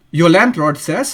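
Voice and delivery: ordinary talk, nothing marked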